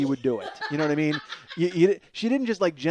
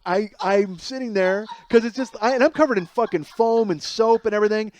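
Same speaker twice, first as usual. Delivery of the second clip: usual speaking voice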